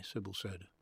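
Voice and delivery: ordinary delivery, nothing marked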